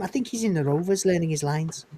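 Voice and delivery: a soft, quiet mock Northern accent. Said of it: "taking the piss out of the accent".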